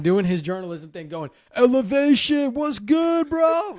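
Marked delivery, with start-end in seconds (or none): 1.6-3.8 high, loud call across the court; yelling greeting. Retold or imitated. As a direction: shout it across the baseline. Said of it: "Like, yelling at me".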